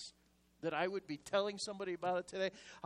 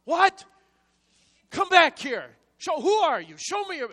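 much higher, much louder furious shouting; arrogant nasty yell; 11 semitones higher, 15 dB louder than usual. Retold or imitated. Driver shouting at disguised ticket lady, arrogant and enraged. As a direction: bark at her like an outraged driver. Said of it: "and really arrogant and nasty".

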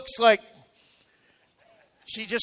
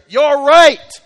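much louder and higher, a shout; loud retort; 5 semitones higher, 12 dB louder than usual. Real, not acted. Preacher shouting back at the complaining worker, loud. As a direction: fire back You're right at full voice. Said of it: "preacher retorts to complainer".

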